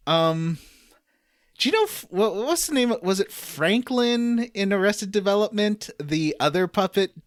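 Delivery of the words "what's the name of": ordinary talk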